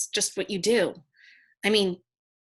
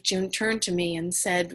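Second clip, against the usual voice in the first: ordinary delivery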